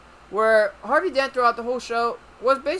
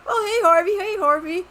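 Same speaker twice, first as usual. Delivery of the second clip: mock greeting; 12 semitones higher, 6 dB louder than usual. Mocking the greeting crowd.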